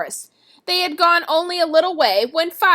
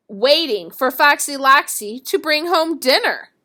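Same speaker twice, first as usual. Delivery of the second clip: usual speaking voice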